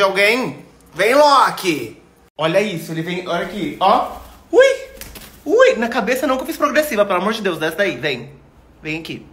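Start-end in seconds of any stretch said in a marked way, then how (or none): 5.4-5.7 sudden high yelp as the bird lands; a real shriek of surprise; startled squeal. Real, not acted. Startled squeal as the parrot lands on her hair.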